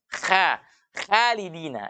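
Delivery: "khalidina" with a thin, front a; tipis, salah. Shown as a demonstration. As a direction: recite "khalidina" slowly with light kh. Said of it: "yang salah adalah ketika kita baca". ("khalidina" dibaca tipis)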